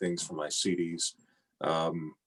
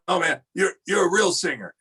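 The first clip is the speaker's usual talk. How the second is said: high mock rasp, much louder; 10 semitones higher, 5 dB louder than usual. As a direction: rasp it out like an old crooner praising a kid. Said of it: "with that raspy voice of his".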